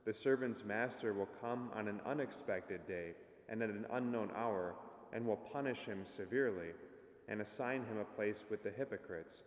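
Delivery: formal liturgical reading, projected; gospel proclamation. Acted. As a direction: proclaim it like a priest at mass.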